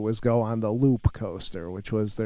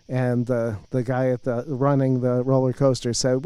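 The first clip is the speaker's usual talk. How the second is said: usual speaking voice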